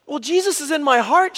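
high, loud mock-tearful explanation; crying child. Little girl explaining to her dad, mock crying. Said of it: "she's crying".

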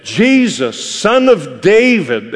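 impassioned retold cry. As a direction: call out over a crowd, pleading. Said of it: "he cried out and he said".

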